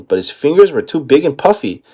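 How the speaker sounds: ordinary delivery, nothing marked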